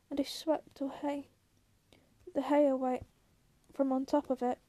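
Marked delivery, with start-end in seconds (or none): none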